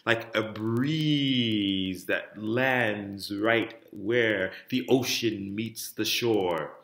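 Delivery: very slow, flowing and connected; legato phrase. Shown as a demonstration. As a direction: stretch it smoothly toward the end of the phrase. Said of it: "in your legato phrase".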